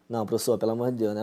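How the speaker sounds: low, soft mock protest; pleading student. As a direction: imitate a student quietly begging the teacher. Voicing a student's protest to teacher.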